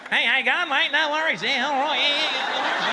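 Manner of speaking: broad, hammed-up Australian accent; ordering a burger like a cartoon Australian; Australian impression. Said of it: "my impression of you guys".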